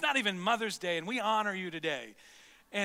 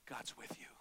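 whispered; 8 semitones lower, 15 dB quieter than usual. Real, not acted. Pastor encourages the congregation.

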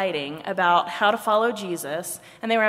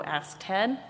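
ordinary delivery, nothing marked

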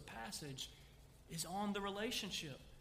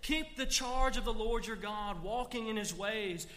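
louder, rousing charge to a son; king charging his son, loud and firm; fatherly charge; 3 semitones higher, 6 dB louder than usual. Acted. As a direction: charge him to be strong. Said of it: "David says to him".

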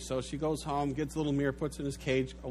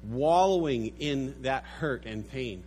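usual speaking voice

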